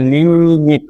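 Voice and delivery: লিমিট drawn out as "liii-mit"; broken into syllables. Shown as a demonstration. Teacher showing learners the two syllables, লিমিট slowly stretched apart. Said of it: "মাত্র একবার ভাঙতে পারছি শব্দটিকে".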